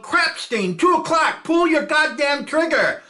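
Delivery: mock yelling at a frozen gunner; retold yell. Gunner retelling how he yelled at Milstein. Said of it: "I yelled".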